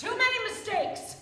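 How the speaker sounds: sharp rebuke, rising loud; angry outburst. Acted. Leader scolding the group for failures, angry. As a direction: snap at them for their failures.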